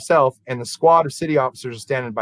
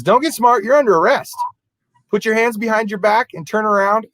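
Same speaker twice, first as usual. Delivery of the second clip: mock cop barking orders, raised and higher; stern cop order; 7 semitones higher than usual. County deputy arresting the night clerk, mock stern command. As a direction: play the hard-nosed deputy laying down the law. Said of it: "And he says".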